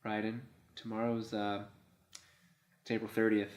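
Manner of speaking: quiet, halting passive-aggressive hinting; hint softly with pauses, mildly needling; Rose. Acted. Girlfriend reminds her boyfriend of the date, understated passive-aggressive. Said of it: "Rose is passive aggressive as fuck".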